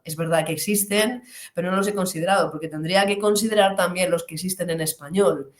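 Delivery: ordinary talk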